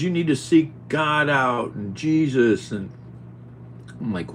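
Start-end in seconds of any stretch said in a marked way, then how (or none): 0.0-2.7 slow, higher mocking imitation; mocking the friend. Imitating the friend telling him to seek God, mocking. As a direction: parody his preaching tone. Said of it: "He says".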